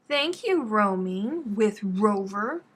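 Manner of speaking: drawn-out slow sing-song thanks; slow sing-song thank you. Real, not acted. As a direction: stretch the name out gratefully.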